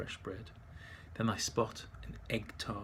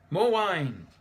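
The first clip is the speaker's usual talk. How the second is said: loud, higher cheerful demand; merry shout for more wine; calling out; 7 semitones higher, 7 dB louder than usual. Acted. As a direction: call for more like a happy drinker. Drinker calls for more.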